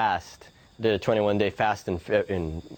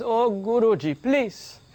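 high pleading mother's voice, pitched up; 9 semitones higher than usual. Retold or imitated. Mother begging the guru to stop her son, high pleading. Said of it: "and she says".

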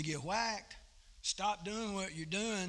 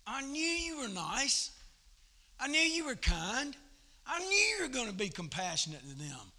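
higher, louder complaining whine; a sulking prophet griping at God; hammed-up whine; 7 semitones higher, 4 dB louder than usual. Jonah complaining to God about Nineveh, hammed-up whine. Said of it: "He was like".

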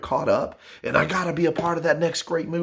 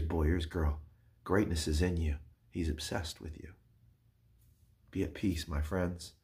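soft closing; 9 semitones lower, 10 dB quieter than usual. Real, not acted.

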